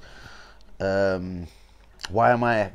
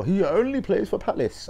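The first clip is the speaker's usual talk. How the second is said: higher whiny mock fan voice; mocking super fan; 10 semitones higher than usual. Imitating super fans cussing him, higher mocking whine. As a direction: sneer it like a whining fan. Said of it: "go on, super fans, cuss me".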